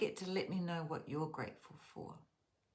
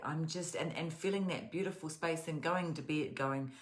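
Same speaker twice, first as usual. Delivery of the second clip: usual speaking voice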